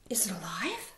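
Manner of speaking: nervous whispered question. Acted. Frightened prairie dog whispering to the crowd. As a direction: whisper it as if afraid.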